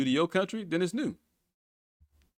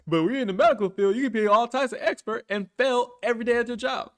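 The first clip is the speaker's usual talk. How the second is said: loud ranting; 10 semitones higher, 4 dB louder than usual. Real, not acted. Loud laughing rant.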